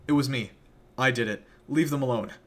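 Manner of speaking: loud, firm outburst; boy shouting a false confession to Penny; defiant confession. Acted. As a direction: throw yourself in front of the others. Billy confesses to save others.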